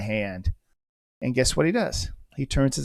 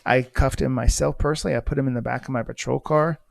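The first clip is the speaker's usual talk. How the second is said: ordinary delivery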